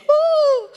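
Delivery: high-pitched "woo!" of a starstruck groupie; mock fan squeal. Not bothering a celebrity customer by squealing, mock fan squeal. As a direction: let out a pretend excited fan whoop. Imagines the fan reaction she avoids.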